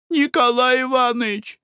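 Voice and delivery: high, urgent call to wake him; frightened call. Acted. Wife wakes husband, frightened.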